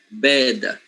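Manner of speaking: "Bed" drawn into two syllables, bed-duh; Italian epenthetic vowel. Shown as a demonstration. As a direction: add a vowel close to A after the D of "Bed". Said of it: "they say bed".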